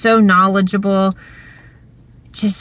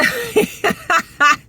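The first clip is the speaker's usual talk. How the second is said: giggling laugh; 11 semitones higher than usual. Retold or imitated. Class laughing at scene.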